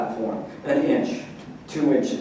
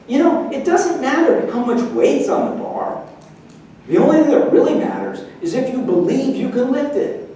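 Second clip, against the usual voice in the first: lightly voiced wise son, pitched up; a hint of a sage master, calm and raised; wise little Yoda; 8 semitones higher than usual. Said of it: "turn into Yoda of the weightlifting".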